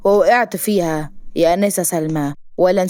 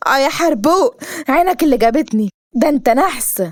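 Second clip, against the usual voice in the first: higher, scolding heroine; acted pitched-up annoyance; indignant accusation; 6 semitones higher than usual. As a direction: blame him hotly. Heroine blames hero.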